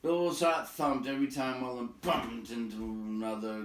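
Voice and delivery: ordinary delivery, nothing marked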